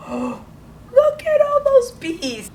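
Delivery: gasp, then a very high, slow, crying-out "look at all those B's"; high, drawn-out teacher wonder; awed gasp. Acted. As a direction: marvel at it like a treasure. Teacher marvelling at the circled letters.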